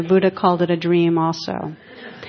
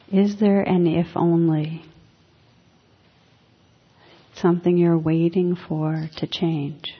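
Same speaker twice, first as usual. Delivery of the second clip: guided reflection. Acted. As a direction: ease listeners inward, letting lines fade. Leads a quiet reflection.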